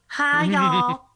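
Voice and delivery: high, loud southern greeting; mock southern accent. Rival wrestler teasingly imitates Blue Rose, mock southern accent. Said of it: "am I doing it right".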